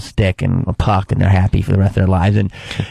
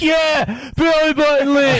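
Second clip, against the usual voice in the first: put-on high squeaky drunk girl chiming in; much higher, shouted eager yell; high mock heckler; slower than usual. Mimicking a drunk heckler, high and eager.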